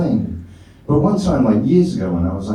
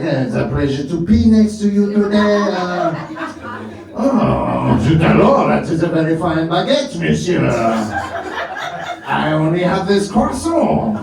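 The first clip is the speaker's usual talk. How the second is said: hammed-up French accent, raised in pitch; mock-French small talk, pitched up; 6 semitones higher than usual. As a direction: chat like a cartoon Frenchman. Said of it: "pee like a Frenchman".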